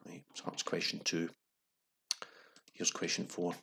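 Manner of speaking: ordinary delivery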